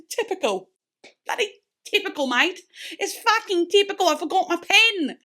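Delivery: hammed-up British accent rising high and loud; exasperated mock British accent; bad British accent. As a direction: put on a terrible cockney accent for the rant. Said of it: "Sorry about the terrible accent".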